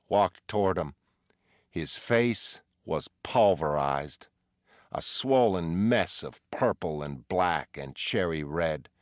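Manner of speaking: usual speaking voice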